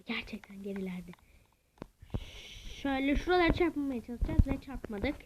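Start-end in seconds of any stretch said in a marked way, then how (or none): 0.0-1.1 hushed, breathy whisper under her breath. Real, not acted. Gamer whispering to viewers about her sister. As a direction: drop to a whisper as if telling a secret. Sister far behind in game.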